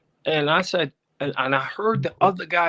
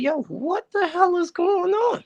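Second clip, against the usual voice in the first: much higher, slow, stunned disbelief; shocked outburst. Retold or imitated. Caller reliving seeing people handcuffed, shocked. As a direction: pitch it up as if caught off guard.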